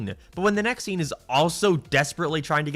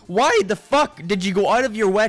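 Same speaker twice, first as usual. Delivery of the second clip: exasperated rant; 6 semitones higher, 4 dB louder than usual. Real, not acted. Critic fed up with the show's story structure, ranting loudly. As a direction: vent at the show, fed up. Ranting about the three-parter.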